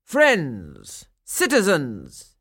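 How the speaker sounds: loud, slow, stretched-out address; calling for attention. A narrator's reading. Burgomaster trying to quiet the crowd, calling out. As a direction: call out each word to hush a crowd. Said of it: "he tried to quiet them".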